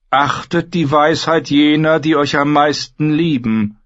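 a silken, coaxing trader; merchant Xaro. Acted. Xaro warns the queen, high and coaxing.

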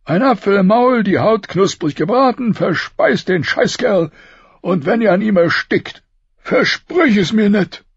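high, strained voice of a dying king. Acted. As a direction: push each word out through pain. Robert's funeral wish.

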